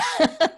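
short high burst of laughter. Real, not acted. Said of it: "This is just for enter".

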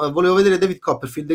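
ordinary talk

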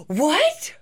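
shocked gasp. Real, not acted. Shocked at missing tooth.

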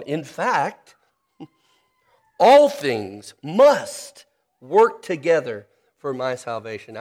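slow, weighty reading that lands hard on "must"; stress on must. Shown as a demonstration. Preacher quoting the catechism to memorize, stress on must. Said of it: "that you emphasize must".

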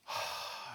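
a long, breathy sigh of relief; relieved sigh. Pretend. The earth sighing at Jesus' return, breathy and relieved. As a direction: let the breath out softly, like deep relief.

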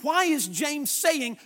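ordinary delivery

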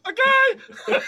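very high, laughing okay; giddy laugh. Retold or imitated. Agreeing to Connery's terms with a giddy laughing okay. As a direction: squeal it through a laugh.

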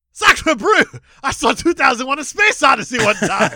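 loud, high mock French director; hammed-up French exclamation; French accent. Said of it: "this is Luc Besson saying".

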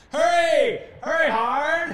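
high loud shout of a sweeper's call; curling call. Retold or imitated. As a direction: yell like a curling skip. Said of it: "You go like".

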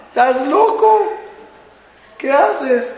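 a rabbi aghast at the blessing; shocked rabbi. Retold or imitated. Shocked rabbi hears the verse.

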